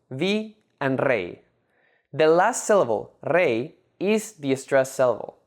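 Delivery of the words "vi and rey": "vi" and "rey" said slowly as separate syllables. Shown as a demonstration. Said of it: "made up of two syllables".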